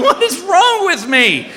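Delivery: high, drawn-out comic wail; acted self-reproach. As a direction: moan at yourself, high and slow. Berates himself while hiding in closet.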